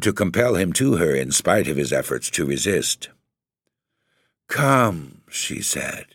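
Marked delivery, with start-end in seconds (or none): 4.5-5.2 a high, drawn-out beckoning word; soft soothing call. A narrator's reading. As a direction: lure them gently toward her. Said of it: "a soft, soothing voice".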